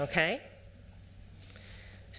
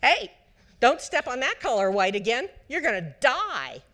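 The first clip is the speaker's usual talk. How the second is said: loud, high mock alarm voice; alarm-brain warning; 8 semitones higher, 6 dB louder than usual. Inner alarm yelling at the child not to step there. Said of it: "the A C C goes off and goes".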